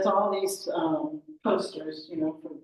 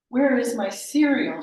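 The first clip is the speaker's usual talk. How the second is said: loud, raised frustrated outcry; higher, exasperated shopper's complaint; 5 semitones higher, 9 dB louder than usual. Acted. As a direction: demand it in exasperation. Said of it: "She's so frustrated".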